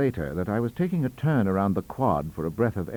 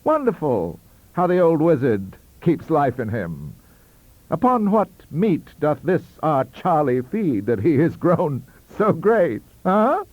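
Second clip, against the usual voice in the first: higher and louder, hammed-up scholarly mockery; a young Joyce man sneering at Dickens, mock grandiose; mocking colleague; 6 semitones higher, 6 dB louder, slower than usual. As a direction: relish the literary joke theatrically. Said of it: "when he added".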